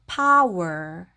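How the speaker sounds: "power" slowly, pow and er blended into one flow; "power" yavaşça, pa-uer birleşik okunur; English power. Shown as a demonstration. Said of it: "power 이렇게 발음하시면 되고요".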